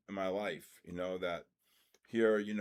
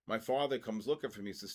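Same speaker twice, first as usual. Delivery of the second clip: ordinary delivery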